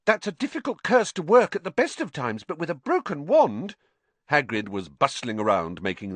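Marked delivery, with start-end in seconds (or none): 0.0-3.8 put-on high-pitched worried girl; anxious know-it-all explanation, kept high; Hermione. A narrator's reading.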